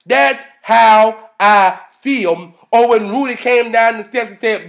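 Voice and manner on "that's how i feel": each word drawn out slowly and loud; slow emphasis. Real, not acted. Speaker driving home agreement with Claire, slow emphasis. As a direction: stretch THAT'S HOW I FEEL word by word. Slowed emphatic repeat of own remark.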